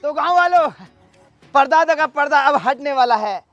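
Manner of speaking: loud public build-up; showman's announcement. Acted. Announces the unveiling to villagers.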